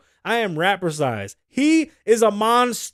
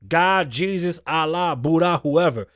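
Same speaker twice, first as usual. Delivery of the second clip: usual speaking voice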